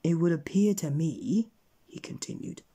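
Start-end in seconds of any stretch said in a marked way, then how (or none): 0.0-1.5 raised, louder verdict; pointed pronouncement. A narrator's reading. Wizard passes judgement on Mister Mean, pointedly. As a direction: announce the judgement with relish.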